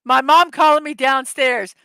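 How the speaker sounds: usual speaking voice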